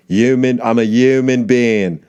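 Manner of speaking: "human" said as yooman, no H, a bit slow; yuman for human. Shown as a demonstration. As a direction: drop the H in "human". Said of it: "they don't say human".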